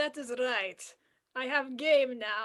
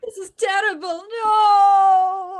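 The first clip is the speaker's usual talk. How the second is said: louder, higher robot crying out in despair; malfunction wail; 6 semitones higher, 10 dB louder than usual. Acted. Computer crying after the wrong module is plugged in, wailing.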